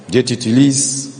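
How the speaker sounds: ordinary talk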